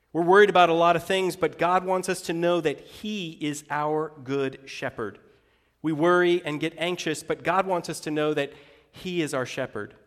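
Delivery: usual speaking voice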